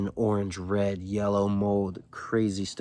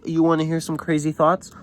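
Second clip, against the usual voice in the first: raised, mildly cocky retelling; mock big-talker announcing his thoughts; popping off; 7 semitones higher than usual. Student popping off on the adults, mock intense. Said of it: "he's just popping off on them".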